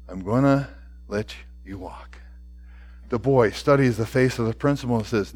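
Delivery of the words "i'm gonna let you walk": low, hushed answer with pauses; soft mercy. A narrator's reading. Principal tells the boy he may go, softly. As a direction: drop down soft and deliberate.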